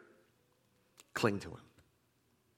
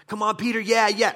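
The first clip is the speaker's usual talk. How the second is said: high, loud cheering like a fan; rooting for him; 10 semitones higher, 8 dB louder than usual. Acted. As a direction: cheer on your quarterback. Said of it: "you're kinda rooting for them".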